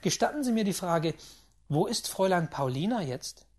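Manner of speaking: loud, fast impatient questioning; an impatient gambler demanding news of Paulina. A narrator's reading. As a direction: push on without letting him speak. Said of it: "unterbrach ich ihn ungeduldig".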